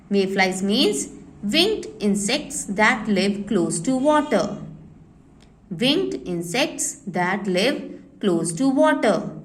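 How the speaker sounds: slow, spaced-out dictation for copying. Shown as a demonstration. Teacher dictating a meaning to children, slowly. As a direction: read it out slowly so they can write. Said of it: "dictating to copying children".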